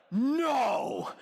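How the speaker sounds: high, drawn-out mock yell; outraged crowd cry. Imitating the movie audience at the betrayal, outraged yell. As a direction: wail it in hammed-up outrage. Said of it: "Everybody's losing their mind, getting angry".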